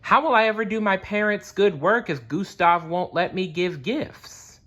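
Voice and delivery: put-on distressed boy. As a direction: plead like a helpless young boy. Nicholas despairs after decree.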